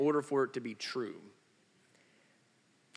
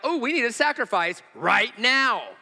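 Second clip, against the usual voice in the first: raised, louder mock exclamation; mock urgency; 6 semitones higher, 5 dB louder than usual. Preacher voicing Abraham and Isaac needing a sacrifice, mock urgency. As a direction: act out a sudden urgent need.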